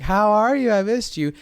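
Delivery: delighted hello. Retold or imitated. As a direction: greet him like you missed him. Warm reunion greeting.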